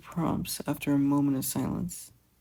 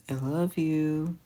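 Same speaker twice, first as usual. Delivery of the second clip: tender; slower than usual. Acted. Brother's tender goodbye.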